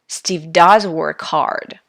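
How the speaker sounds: "does" held long and stressed; slow, deliberate, weight on "does". Shown as a demonstration. Teacher repeating an example for students, stress on "does". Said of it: "how I pronounce these sentences".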